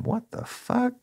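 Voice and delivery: high disbelieving squeak of shock. Retold or imitated. Cop staring at burned genitals, high shocked disbelief. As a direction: let the pitch jump up in disbelief.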